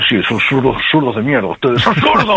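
Argentine political rant impression; mock-furious Argentine tirade, climbing sharply at the end; Milei. Shown as a demonstration. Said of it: "Milei, por ejemplo".

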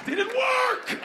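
high, frustrated squeal; high squealing shout. Pretend. High pitched exclamation.

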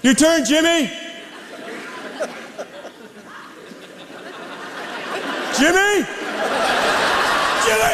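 rising impatient calls; calling out. Real, not acted. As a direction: shout for Jimmy, building. Calling audience member Jimmy.

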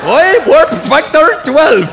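high, loud, slow mock Scottish yell; Scotty. Comic mocks the starship engineer, in a drawn-out garbled Scottish shout. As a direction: drag it out in a mangled fake brogue. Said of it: "Scotty in Star Trek".

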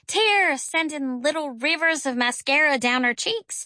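ordinary delivery, nothing marked

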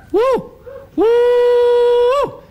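high loud whooping call; mating call again. Retold or imitated. Old bloke repeats the bunyip mating call, loud high whoops. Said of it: "you call again".

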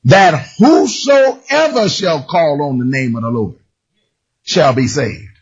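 slow, solemn "whosoever shall call"; scripture reading. Acted. Preacher reads the verse to the congregation. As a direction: read each word with weight.

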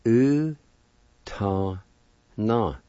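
"U, ta na" as separate drawn syllables, pause after each. Shown as a demonstration. Teacher breaks down first part of piano word, syllable by syllable. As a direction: sound out "U, ta na" piece by piece. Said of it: "First part is pronounced like this".

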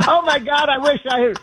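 high, laughing exclamation. Real, not acted. Guest laughing at the host's Trump story, amused disbelief.

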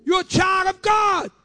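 ordinary talk, nothing marked

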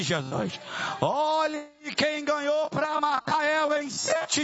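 very high, sustained rallying shouts; full-force exorcism preaching. Real, not acted. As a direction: whip the congregation up with high, ringing commands. Leads congregation in exorcism shouts.

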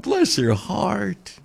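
"Bless her heart" drawn out slowly in a Southern drawl. Shown as a demonstration. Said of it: "that's what we say here in the South".

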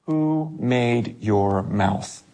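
very slow, weighted question; solemn rebuke. Acted. God confronting Moses over his excuses, solemn slow rebuke.

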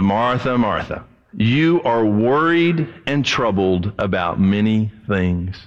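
slow, low tender rebuke; gentle Jesus. Acted.

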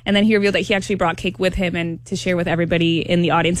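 ordinary delivery

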